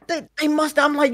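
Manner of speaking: ordinary talk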